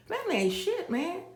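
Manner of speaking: mock incredulous. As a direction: pitch it way up in disbelief.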